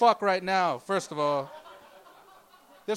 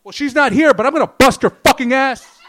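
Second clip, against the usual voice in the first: loud, higher tough-guy bark; aggressive gym challenge; 5 semitones higher, 10 dB louder than usual. Retold or imitated. Comic picks a fight with gym guys, loud mock aggression. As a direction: pick a fight with a roided-up stranger. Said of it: "Just go".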